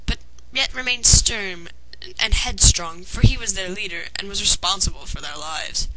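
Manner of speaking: plain reading voice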